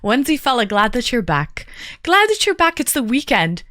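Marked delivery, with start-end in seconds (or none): 2.0-2.8 higher, brighter burst of welcome; enthusiastic welcome. Real, not acted. Streamer welcoming a returning viewer back, enthusiastic. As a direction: repeat it with real enthusiasm.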